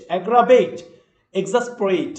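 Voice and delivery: ordinary talk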